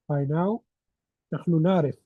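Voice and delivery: normal talking voice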